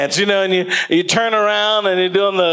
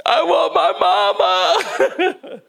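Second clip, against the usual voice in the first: put-on high, slow, drawn-out baby whine; whiny sick kid; 13 semitones higher, much slower than usual. Grown college kid sick, whining slowly for mom. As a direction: wail it like a sick little child. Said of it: "I was like".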